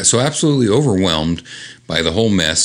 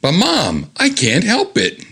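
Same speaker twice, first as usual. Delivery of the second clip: put-on higher pleading teenager; whiny teen girl; 11 semitones higher than usual. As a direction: whine it like a caught kid. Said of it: "here's the young girl".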